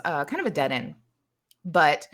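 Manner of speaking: normal talking voice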